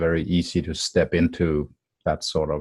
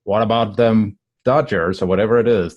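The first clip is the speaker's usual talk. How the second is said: mock small talk; 4 semitones higher, 5 dB louder than usual. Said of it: "messed up by somebody who comes in".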